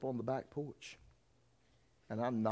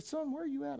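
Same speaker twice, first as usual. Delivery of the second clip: a higher, worried mother's voice; mother on the phone; 14 semitones higher than usual. Retold or imitated. Son retelling his mother's call, lightly higher.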